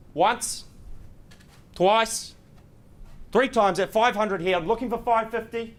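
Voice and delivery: loud, rising auction count; auctioneer. Real, not acted. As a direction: call it out like an auctioneer.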